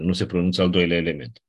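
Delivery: usual speaking voice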